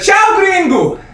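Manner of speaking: high, loud called-out goodbye; cousin's shouted farewell. Retold or imitated. Cousin shouts goodbye at the airport drop-off, high mock shout. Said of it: "looked at me and said".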